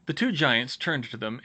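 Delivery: ordinary delivery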